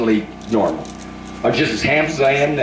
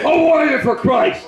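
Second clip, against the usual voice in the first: high, shouted renunciation, much higher; shouted prayer; 14 semitones higher than usual. Real, not acted. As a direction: cast it out at the top of the voice.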